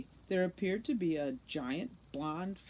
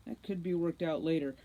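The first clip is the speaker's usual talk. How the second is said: plain reading voice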